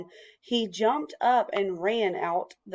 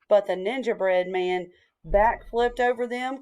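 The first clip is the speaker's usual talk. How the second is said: ordinary delivery, nothing marked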